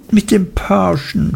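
a touch of sobbing through the words; understated tearful young wife. A narrator's reading. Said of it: "schluchzte Dora".

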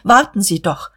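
usual speaking voice

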